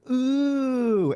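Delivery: long, drawn-out high "ooh"; awed audience ooh. Retold or imitated. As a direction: imitate an impressed crowd going ooh. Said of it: "everyone in the audience goes".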